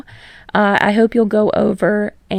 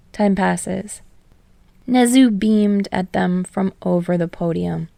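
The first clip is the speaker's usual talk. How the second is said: plain reading voice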